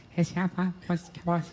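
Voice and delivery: put-on soft, indistinct child murmur; mumbling little girl. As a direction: imitate a child's unintelligible mumbling. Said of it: "she was just standing in the corner just going".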